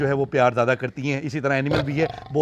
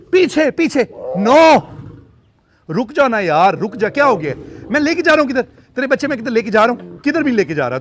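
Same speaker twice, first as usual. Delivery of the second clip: higher, louder pleading with the cat; real alarmed shout; 6 semitones higher, 6 dB louder than usual. Real, not acted. Owner argues with angry mother cat over kittens, loud pleading. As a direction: push her back, half pleading half scolding.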